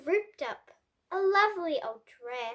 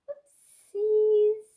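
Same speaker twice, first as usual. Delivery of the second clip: higher, louder cartoon oopsie; playful oopsies; 4 semitones higher, 4 dB louder than usual. A narrator's reading. Reader reacting to Pig's fall, playful oopsie. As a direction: sing it like a cheeky mishap. Bowling ball falls on Pig.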